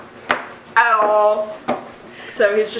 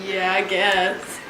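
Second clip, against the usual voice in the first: mock shrug; 3 semitones lower, 4 dB quieter, much slower than usual. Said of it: "Andres is like".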